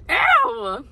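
high disgusted yelp; disgusted squeal. Real, not acted. Disgust at candy.

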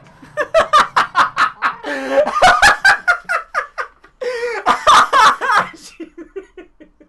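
high, loud, wheezing fit of laughter; real laughing fit; long hysterical laugh. Real, not acted. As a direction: howl with laughter at your own punchline. Punchline lands, long laugh.

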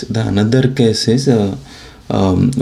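ordinary talk

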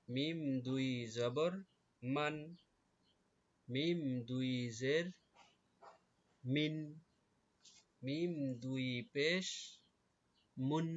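stretched মিম, rising before each answer; measured drill of মান, মিন, মুন; drilled spelling recitation. Shown as a demonstration. Teacher asking pupils to note closely, measured drill recitation. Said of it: "খুব খেয়াল".

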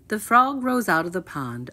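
usual speaking voice

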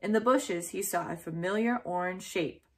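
usual speaking voice

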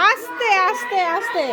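loud, high warning; calling out. Real, not acted. Calling out to a rowdy child. (জোরে সাবধান করা)

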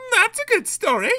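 high, loud, self-satisfied diesel voice; gleeful. A narrator's reading. Daisy pleased at dodging the van, gleeful. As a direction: gloat in a high put-on voice. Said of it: "she chuckled".

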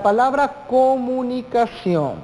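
"comunicación" stretched slowly, syllables held long; slow and drawn out. Shown as a demonstration. Teacher presents the example word, slowly drawn out. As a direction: draw out each syllable of "comunicación".

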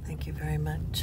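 quick, soft whisper; whispered thanks. Real, not acted.

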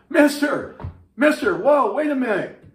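high, loud call after someone riding away. Acted. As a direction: yell to stop a rider leaving town. Bartender calls after departing cowboy.

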